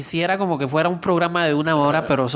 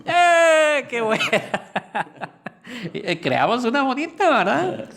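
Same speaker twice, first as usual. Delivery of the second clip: laughing; 7 semitones higher than usual. Real, not acted. Delighted at finished story.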